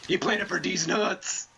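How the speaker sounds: slow, high, loud taunt; mocking yell. Real, not acted. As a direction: drag it out to mock him. Mocking enemy plant, music.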